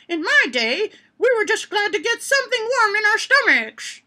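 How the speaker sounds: a high, lightly voiced old grandma; scornful grandma. Grandma topping her daughter's story, lightly voiced. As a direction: boast it like a smug old grandma. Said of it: "said the grandma scornfully".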